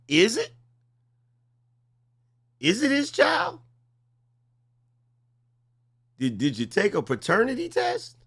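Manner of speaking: teasing her with a high, mock-horrified question; mock-shocked squeal. Real, not acted. Host teasing doubt about paternity.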